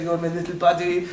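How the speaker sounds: Jean-Claude Van Damme. Pretend. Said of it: "it answers as Jean-Claude Van Damme on Skype".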